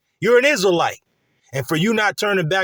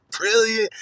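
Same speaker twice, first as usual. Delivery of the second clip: mimicking a gleeful chuckle into a higher-pitched word; put-on laughing delivery; imitated laughing; 5 semitones higher than usual. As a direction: chuckle first, then say it in mock delight. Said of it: "he's kinda laughing like".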